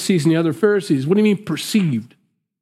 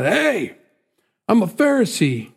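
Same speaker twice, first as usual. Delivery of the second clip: mock yell; 5 semitones higher, slower than usual. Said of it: "yells it out at the top of his lungs".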